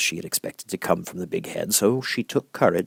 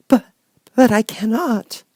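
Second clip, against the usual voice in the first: put-on much higher, louder startled girl's cry; taken aback, she blurts it out; surprised exclaim; 7 dB louder than usual. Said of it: "exclaimed Dorothy, greatly surprised".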